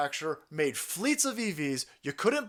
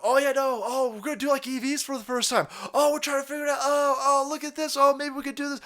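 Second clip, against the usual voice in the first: high, airy fake-amazed voice; mock clueless carmakers; 9 semitones higher than usual. Carmakers pretending EVs are new, high mock amazement. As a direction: play dumb like a carmaker inventing EVs.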